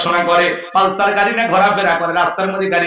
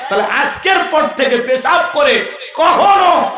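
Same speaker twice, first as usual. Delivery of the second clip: higher, shouted sermon line; fiery exhortation; 7 semitones higher than usual. Acted. Preacher warning the faithful, shouting. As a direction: drive the point home with a shout.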